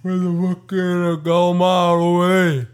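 high, loud, stretched New York Italian holler. Acted. Showing the friend the talk he means, loud exaggerated New York Italian accent. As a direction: bellow a hammed-up New York Italian line. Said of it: "New York Italian kind of thing".

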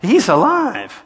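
loud, high preacher's cry; fiery preaching at the top of the voice; full-force preaching. Real, not acted. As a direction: preach it out hard. Preaching climax.

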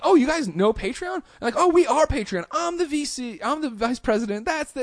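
hammed-up squealing excitement; overexcited gushing. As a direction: play it up like a thrilled superfan. Mock-excited reply to fans.

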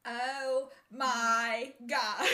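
loud, very slow drawn-out nasal whine; Janice impression. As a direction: stretch every word out loud and slow. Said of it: "that was the first voice that came to mind".

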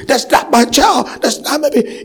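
high-pitched imitation of a rejecting father; acted-out refusal; father's angry rejection. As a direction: retell his protest, voice jumping up. Father rejects his newborn in story.

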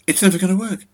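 rising panicked cry; voice risen in panic. A narrator's reading. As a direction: let the voice climb as panic takes her. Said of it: "her voice had risen a pitch".

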